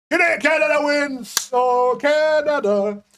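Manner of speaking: high, drawn-out victory cheer; excited cheer. Real, not acted. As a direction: crow the win, stretching out Oh Canada. Celebrating the vote result.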